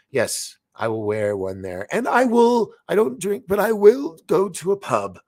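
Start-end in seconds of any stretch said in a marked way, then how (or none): 1.9-5.2 much higher, louder eager rush; bursting with glee about the pub; excited. Real, not acted. Telling viewers about his Scotland trip, excited and high.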